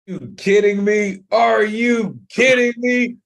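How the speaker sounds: ordinary delivery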